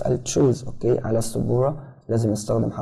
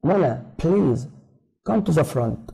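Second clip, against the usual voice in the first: acting the teacher; 5 semitones higher, much slower than usual. Teacher voiced in dialogue.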